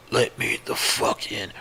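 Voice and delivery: whispered, unnatural creature voice; halting, weird voice. Acted. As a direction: whisper it like an animal mimicking speech. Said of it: "in this halting, weirdly toned voice".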